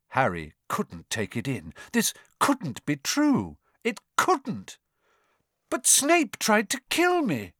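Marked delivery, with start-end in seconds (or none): 5.7-7.5 high, shocked protest. A narrator's reading. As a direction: blurt it out in disbelief. Harry protests.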